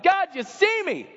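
high, pleading cry for attention; needy, desperate call to God; frantic plea to be seen; frantic striver. Acted. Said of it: "running around frantic saying".

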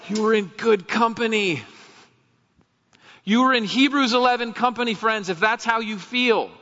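high, loud preacher's proclamation; impassioned preaching. Real, not acted. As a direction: ring it out to the congregation. Preaching at full force.

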